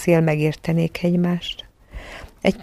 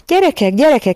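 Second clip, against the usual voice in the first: raised, higher-pitched urgent call; 6 semitones higher, 4 dB louder than usual. A narrator's reading. Mrs Banks bursts in on the children, urgent call. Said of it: "kiáltotta Banks mama".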